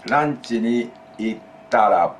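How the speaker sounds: slow, careful teacher example; slow model phrase. Shown as a demonstration. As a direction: give it slowly so students can copy. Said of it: "After you go to lunch".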